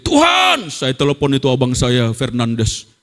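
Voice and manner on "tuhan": high-pitched cry out to God; retold scream. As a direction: re-enact a drawn-out scream to heaven. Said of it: "Saya menjerit". (jeritan kepada Tuhan)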